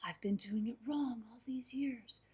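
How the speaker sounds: put-on old guru's humble whisper; old monk whispering his thanks. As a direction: hush it like a gentle old master. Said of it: "the old man looked at him and said".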